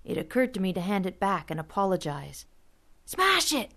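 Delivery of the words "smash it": high yelled order to smash it; screamed command. A narrator's reading. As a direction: shout it at your friend urgently. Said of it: "Rusty and Vaco screamed".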